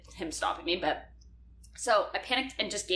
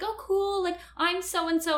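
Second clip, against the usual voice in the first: high imitating voice; 11 semitones higher, slower than usual. Quoting the guy.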